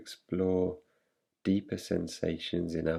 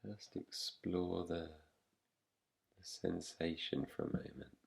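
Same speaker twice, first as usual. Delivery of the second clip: soft, slightly low voice with long pauses; guided meditation; 3 semitones lower, 6 dB quieter than usual. Real, not acted. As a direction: guide them gently into rest, leaving long silences. Leads a yoga nidra practice.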